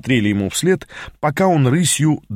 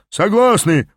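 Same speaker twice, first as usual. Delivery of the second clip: higher, mildly roared agreement; group roar; 9 semitones higher, 4 dB louder, slower than usual. A narrator's reading. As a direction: roar it lightly, as a crowd agreeing. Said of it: "проревели вейгасцы".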